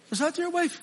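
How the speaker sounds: high, quick query; mock surprised question. Preacher mimicking the evangelist asking about the wife, high. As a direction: lightly voice the preacher pointing at her.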